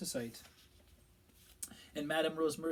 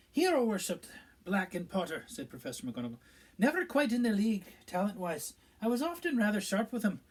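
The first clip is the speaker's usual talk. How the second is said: a higher, louder stern teacher voice; Professor McGonagall; 8 semitones higher, 8 dB louder than usual. A narrator's reading. Professor recalling a former student, stern and regretful.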